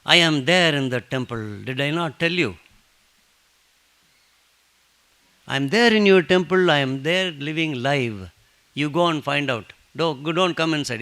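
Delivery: mock-annoyed saint scolding a devotee; a little angry. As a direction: scold the pestering devotee, half exasperated. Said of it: "Baba gets a little angry".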